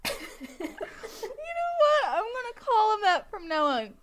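high voice just after helpless laughter; real laughing fit. Real, not acted. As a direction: talk while recovering from laughing hard. Speaking straight out of laughing fit.

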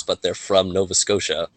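ordinary talk

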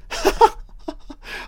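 high breathless burst of laughter; delighted laugh. Real, not acted. Viewer thrilled by a horror film doll, high gleeful laugh.

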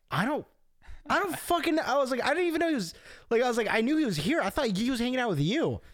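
high-pitched, flustered protest; flustered retelling. Retold or imitated. Friend retelling his reply about a missing buddy, flustered. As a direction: act out a baffled denial, voice jumping high.

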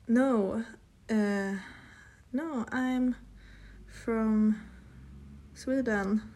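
halting, word by word with long gaps; dictating while typing. Real, not acted. Answering a date's message, halting dictation. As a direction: speak each word as you type it.

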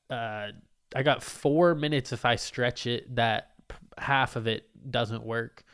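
usual speaking voice

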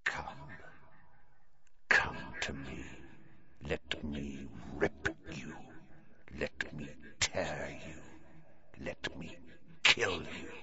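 slow, low, ghostly whisper; ice-cold menace with long pauses; chilling venomous voice. A narrator's reading. Unseen voice luring its prey to kill, venomous. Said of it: "A voice to chill the bone marrow".

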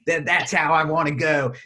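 lightly put-on Philly accent, unbothered; mock Ed. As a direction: voice the Philly guy's cheerful exit line. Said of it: "he's like".

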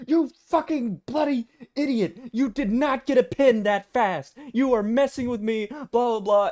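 high, loud enraged screaming; furious yell. Retold or imitated. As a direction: scream it at him like a furious caller. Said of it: "I did not mean to lose my temper".

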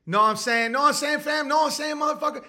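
high, escalating slang challenge; acted-out threatening street talk; mock street confrontation. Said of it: "If you start saying shit like".

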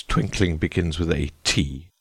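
low, slow, cutting reply; sharp king. A narrator's reading. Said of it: "said the king sharply".

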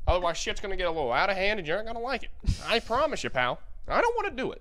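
mock tough guy threat. Friend plays a bar heavy threatening a loudmouth, hammy. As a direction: warn him off like a movie heavy.

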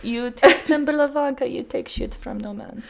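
playing grandpapa with a hard Russian accent; mock-stern orders in a put-on Russian accent. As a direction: lay down the law like a Russian grandpa. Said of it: "I'll be her grandpapa".

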